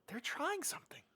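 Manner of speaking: whispered aside of discovery; very soft, voiceless whisper; hushed realisation. Retold or imitated. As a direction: whisper it like you've just caught on. Retold hushed realisation while watching film.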